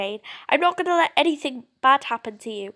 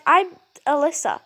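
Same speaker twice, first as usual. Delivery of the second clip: ordinary talk, nothing marked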